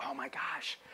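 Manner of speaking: hushed, breathy-free whisper of disbelief; awed whisper. Retold or imitated. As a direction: whisper it like you can't believe your luck. Retelling awe at meeting the CEO.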